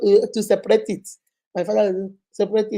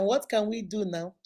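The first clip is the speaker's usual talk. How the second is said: ordinary delivery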